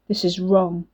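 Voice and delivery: ordinary delivery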